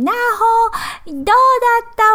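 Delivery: put-on dad's bright greeting to his little girl; father calling his daughter. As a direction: sing out the name like a happy dad coming home. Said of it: "to call my daughter's name".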